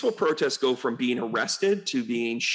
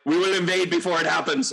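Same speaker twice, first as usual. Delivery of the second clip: louder, stern mock threat; mock Russia; 5 semitones higher, 4 dB louder than usual. Mocking Russia threatening Ukraine, louder mock threat. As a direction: lightly growl it as a threat. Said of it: "they went".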